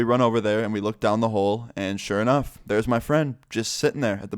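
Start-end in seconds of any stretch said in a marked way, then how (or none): none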